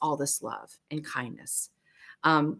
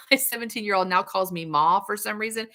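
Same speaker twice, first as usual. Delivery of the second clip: ordinary talk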